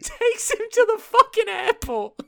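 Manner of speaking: high, laughing disbelief; laughing hard. Real, not acted. Friend recounting the absurd airport scene, laughing hard. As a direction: crack up while telling it.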